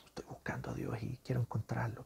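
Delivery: soft whispered longing. Acted. Voicing the seeker, a hushed whispered longing. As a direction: whisper it like a private wish.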